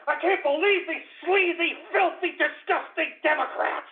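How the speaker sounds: high, strained outraged rant; incredulous high-pitched yelling; furious. Real, not acted. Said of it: "I'm so goddamn angry".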